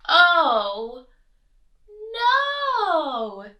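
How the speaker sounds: loud, high, two separated wails; big drawn-out storybook despair; exasperated bear. A narrator's reading. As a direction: wail it with a pause between. Bear despairs in noisy kitchen.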